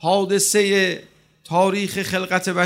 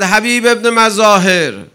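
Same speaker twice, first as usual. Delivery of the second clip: calling out; 5 semitones higher, 9 dB louder than usual. Acted. Imam calls out to Habib.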